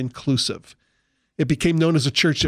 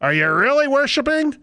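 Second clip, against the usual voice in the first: high, slow, preachy questioning; mock worship leader; 4 semitones higher than usual. Preacher mimics a worship leader pressing the congregation, high and slow. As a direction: lean on each question like a fervent leader. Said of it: "We do the same thing in church".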